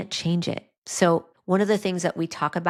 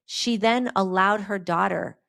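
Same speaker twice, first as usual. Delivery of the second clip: ordinary talk, nothing marked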